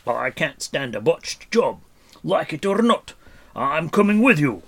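loud, indignant character voice, pitch leaping; furious shouting. A narrator's reading. Furious dwarf refusing to leave his companions, shouting. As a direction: rant at them in outrage. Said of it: "he shouted".

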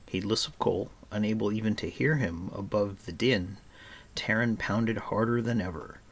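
ordinary delivery

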